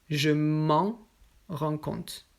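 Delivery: "Je m'en rends compte" very slowly; slow model. Shown as a demonstration. Teacher modelling slowly for learners. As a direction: stretch "m'en" so it lands. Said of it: "model sentence".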